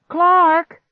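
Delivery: very high, loud cry; calling out. A narrator's reading. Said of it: "I called out again".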